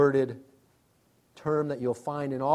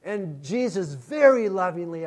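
usual speaking voice

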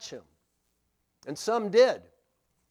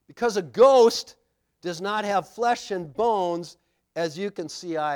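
emphatic reading; 3 semitones higher, 8 dB louder than usual. Acted. Reading Jesus' words emphatically.

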